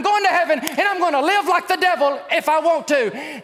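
high, loud, defiant shout of a careless believer. Retold or imitated. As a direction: mimic a smug churchgoer who doesn't care.

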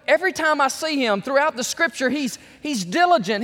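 ordinary talk, nothing marked

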